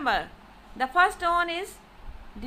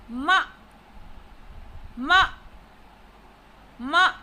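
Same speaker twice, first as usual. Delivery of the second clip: "ma" short and loud, one quick burst; tonal variation of ma; 5 dB louder than usual. Shown as a demonstration. Teacher showing the word for scold, "ma" short and forceful. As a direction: punch each "ma" out briefly, well apart. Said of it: "look at the tonal variations of ma".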